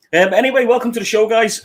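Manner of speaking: high, loud host greeting; excited show welcome. Real, not acted. Host welcoming viewers to the show, bright and excited. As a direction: throw the welcome out like a presenter.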